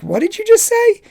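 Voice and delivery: incredulous coworker. Retold or imitated. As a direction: voice the big cook's disbelief. Coworker reacts to "Holy Moses.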